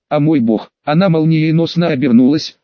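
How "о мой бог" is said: ordinary delivery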